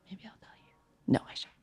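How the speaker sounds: soft unvoiced whisper; conspiratorial whisper. Real, not acted. Comic teasing the audience with a secret, whispered. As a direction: lean in and tease a secret. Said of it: "teasing about revealing a name".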